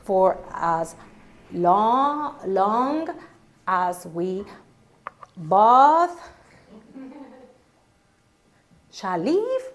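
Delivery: "as long as we both shall live" broken up slowly with long gaps; confused bride stumbles through the vow; halting vow. Retold or imitated. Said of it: "Here it comes".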